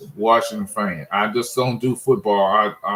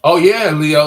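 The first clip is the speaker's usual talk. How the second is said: much louder, higher hail to a friend; calling out; 4 semitones higher, 10 dB louder than usual. Real, not acted. Fan answering viewer Leo in the chat, loud higher call out. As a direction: shout the name across the room.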